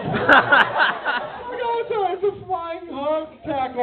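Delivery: high, small explaining voice; high-pitched mock fan voice; imitated fan. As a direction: play a sheepish fan explaining herself.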